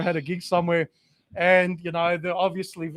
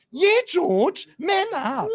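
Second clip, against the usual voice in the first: steward mocking George; 12 semitones higher, much slower than usual. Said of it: "And he goes".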